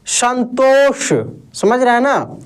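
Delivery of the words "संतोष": संतोष said slowly, the ष from the roof of the mouth; slow clear demo. Shown as a demonstration. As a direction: stretch out संतोष with a retroflex ष. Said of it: "इसका उच्चारण दोस्त मूर्धा से होता है". (संतोष धीरे और साफ़, मूर्धा से ष)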